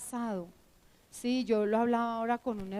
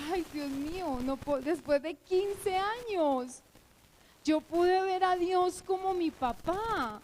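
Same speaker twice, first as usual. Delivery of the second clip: slow, higher-pitched astonishment; re-enacted awe, rising in pitch; retold amazement; 6 semitones higher than usual. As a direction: marvel at it, drawing the words out. Retells amazement after fifteen years.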